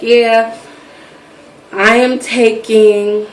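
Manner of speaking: very slow, drawn-out words; retold halting admission, stretched out; slow, dreading confession. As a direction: stall before the embarrassing reveal. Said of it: "telling her so slow because I'm, like, dreading her".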